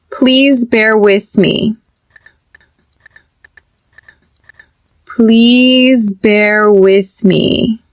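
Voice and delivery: slow, clear building drill; slow repeat drill. Shown as a demonstration. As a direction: build the phrase up slowly for students to echo. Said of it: "Repeat after me".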